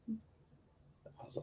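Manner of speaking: hushed breathy whisper. Real, not acted. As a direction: drop to a whisper. Whispered aside.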